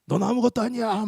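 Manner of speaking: high, scornful imitated cry; mock demon yell. Demon belittles the pastor, high mock yell. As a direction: imitate a demon yelling contempt. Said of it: "저한테 소리를 지릅니다".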